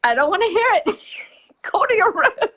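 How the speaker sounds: high, loud hammed-up brush-off; sending fear to its room, half laughing; mock dismissive parent. Telling fear and anger to go away, mock high loud brush-off. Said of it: "whenever we tell fear and anger".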